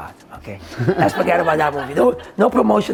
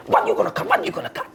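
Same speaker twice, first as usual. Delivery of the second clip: high-pitched, repeated challenge; challenging retort; much faster than usual. Retold or imitated. Washer challenging the man with a sword, high retort. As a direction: question him in a raised, goading pitch.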